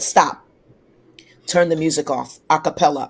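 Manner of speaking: curt command. Retold or imitated. Voices auditioners cutting her off.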